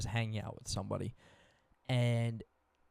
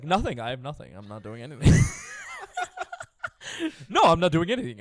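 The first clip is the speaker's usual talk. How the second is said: mock eager; 5 semitones higher, 10 dB louder, faster than usual. Retelling her eager yes to a date.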